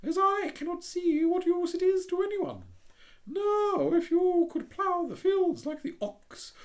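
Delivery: prim little duck voice, high. Acted. The Duck, louder.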